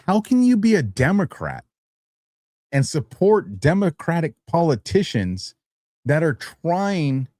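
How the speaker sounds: high, slow, outraged questioning; indignant rant. Real, not acted. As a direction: pitch climbing in disbelief. Indignant rant about Democrats.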